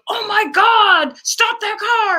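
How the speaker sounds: high, loud, slow pleading yell; frantic yell. Retold or imitated. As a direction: yell at him to stop the car right now. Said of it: "'cause I was like".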